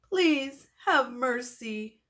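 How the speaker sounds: slow, pleading monster; defeated mummy pleading; begging. Acted. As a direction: beg for mercy dramatically. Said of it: "Marvin said".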